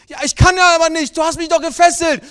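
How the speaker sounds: high, loud desperate protest; pleading child. Acted. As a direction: plead like a frightened boy. Son pleading with angry father.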